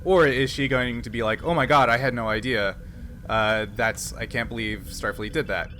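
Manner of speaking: ordinary talk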